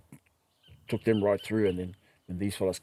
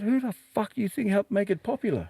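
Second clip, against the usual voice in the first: high, heated comeback at the ticket lady; indignant retort; 10 semitones higher than usual. Retold or imitated. Retelling a phone call, an indignant comeback to the lady. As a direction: fire it back, offended.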